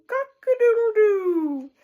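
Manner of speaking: high, slow crowing; rooster crow. Acted. Rooster crows at dawn.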